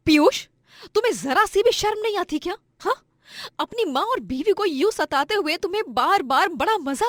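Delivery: high, indignant telling-off; angry scolding. Acted. Wife confronting husband over stolen medicine money, angry scolding.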